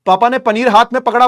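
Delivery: usual speaking voice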